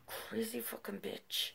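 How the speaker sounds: hissed under the breath; muttered whispered insult; whispering an insult at the neighbor. Retold or imitated. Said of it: "I'm like".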